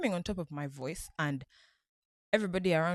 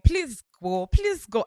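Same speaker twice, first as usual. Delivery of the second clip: louder shouted plea, the second one higher; screaming; 7 semitones higher, 8 dB louder, slower than usual. Retold or imitated. Fan retelling urging a girl onto stage, mock shout. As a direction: yell it to the singer from the crowd, retold. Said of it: "we kept on screaming".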